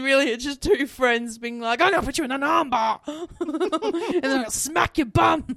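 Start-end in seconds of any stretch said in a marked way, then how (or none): 1.8-5.5 raised, hammed-up mock threats; playful roughhousing voice, breaking into laughter; play-fight taunting. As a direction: tease a friend with silly threats. Friends acting out a play fight.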